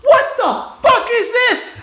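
high, drawn-out outraged yell; angry yell. Retold or imitated. Furious customer out of the chair at his barber, angry yell. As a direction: re-enact blowing up at the barber. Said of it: "I straight up yelled at him".

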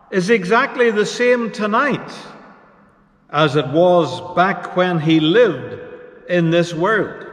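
normal talking voice

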